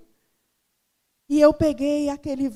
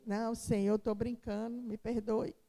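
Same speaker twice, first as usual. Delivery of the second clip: much softer and lower, pleading; quiet plea; 3 semitones lower, 9 dB quieter than usual. Retold or imitated. She begs the Lord's forgiveness for complaining, soft lower plea. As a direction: take it back humbly before God. Praying, asking God's forgiveness.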